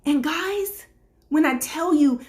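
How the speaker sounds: ordinary talk, nothing marked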